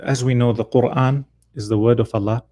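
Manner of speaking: usual speaking voice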